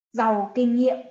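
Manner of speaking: ordinary talk, nothing marked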